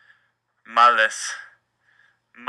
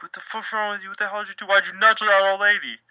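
much higher-pitched scolding; scolding the dog; 9 semitones higher than usual. Retold or imitated. Owner scolding dog for nudging an old lady, high scolding. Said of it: "I'm like".

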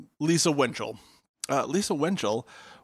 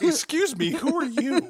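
high put-on voice, much higher; co-host mocks an Australian teen barging in, high-pitched; mock Australian girl; 12 semitones higher, 4 dB louder than usual. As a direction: play a girl who barges in, high and bright. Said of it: "she wheels in ... like".

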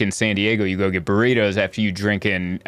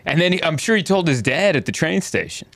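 ordinary talk, nothing marked

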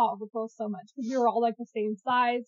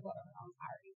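very soft, higher-pitched retreat; retold sheepish apology; 5 semitones higher, 22 dB quieter, faster than usual. Child to annoyed mom, backing down quietly. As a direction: back down, voice dropping to almost nothing.

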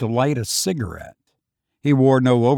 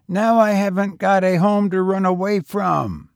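higher, youthful lad's voice; street boy; 6 semitones higher, 4 dB louder than usual. A narrator's reading. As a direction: play the lonely young tramp, higher. Boy's voice.

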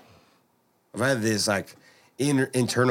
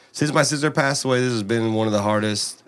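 normal talking voice

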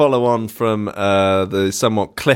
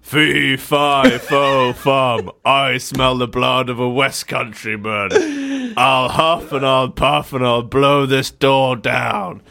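slow storybook-giant boom, raised in pitch; 4 semitones higher, slower than usual. Acted. Charmaine threatens those inside from the door, booming. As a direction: boom it out slowly like a fairy-tale giant. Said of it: "Then a booming voice echoes around".

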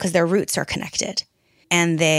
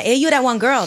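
Hawaiian pidgin, pitched higher and louder; Hawaiian pidgin accent; 7 semitones higher, 6 dB louder than usual. Retold or imitated. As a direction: toss it off like a local in Hawaiian pidgin. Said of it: "That's how they talk over there".

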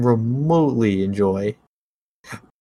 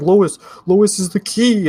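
a higher, louder, slow mock Batman; Batman quote; 9 semitones higher, 5 dB louder than usual. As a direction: draw out the line like a brooding hero. Batman quote in a movie rant.

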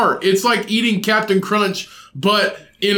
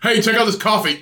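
usual speaking voice

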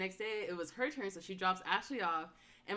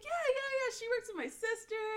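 mock chirpy mom. Retold mom cheerfully explains friendship.

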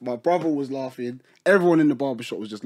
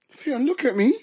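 higher mum voice, puzzled; mock suspicious mum; 10 semitones higher than usual. Imitating his mum asking why he looks weird, pitched up. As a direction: play the mum peering at him. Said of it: "my mum was like".

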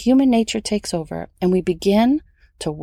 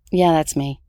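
ordinary talk, nothing marked

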